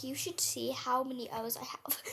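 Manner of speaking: conspiratorial whisper; whispered confession to a co-host; whispered aside. Real, not acted. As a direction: lean in and whisper it.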